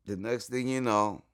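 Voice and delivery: normal talking voice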